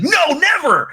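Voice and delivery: high, loud hammed-up cry of refusal, laughing; mock Nick outburst. Friends mocking Nick's stubbornness, loud laughing yell. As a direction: belt out a cartoonish no as the hothead. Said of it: "And Nick would be like".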